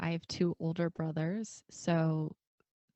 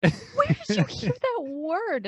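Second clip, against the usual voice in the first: shocked mom; 13 semitones higher, 7 dB louder than usual. Retold or imitated. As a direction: demand it like a scandalized mother. Mom shocked at child's swearing.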